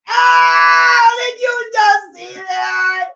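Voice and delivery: high, very loud drawn-out cry; real sports-fan outburst, loud and high; outraged shouting. Real, not acted. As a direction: yell in disbelief at the play. Outraged reaction to a play.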